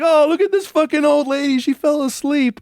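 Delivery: put-on high, loud teenage jeer; mocking laugh-shout. Boys jeering at a drooling old lady, high and loud. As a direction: jeer loudly at the screen.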